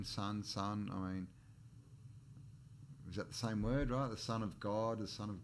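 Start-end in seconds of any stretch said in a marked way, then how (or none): none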